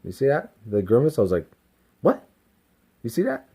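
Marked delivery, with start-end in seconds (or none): 2.0-3.5 high, rising demonstration of a challenge; retold defiant comeback. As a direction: show how the ego talked back. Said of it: "That's how I said".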